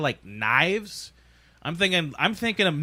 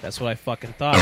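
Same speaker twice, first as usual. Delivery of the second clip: normal talking voice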